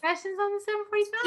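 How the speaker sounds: high, fast, eager reading out; excited. Real, not acted. Host thrilled by the guest's book, high and fast. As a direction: rattle it off excitedly.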